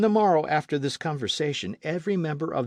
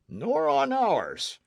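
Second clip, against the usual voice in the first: in unison; 13 semitones higher, much slower than usual. Acted. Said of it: "replied unanimously all".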